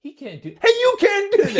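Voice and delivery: very high, loud, laughing squeal; imitating Biff. Imitating Biff's outrage to a friend, very high. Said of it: "He goes".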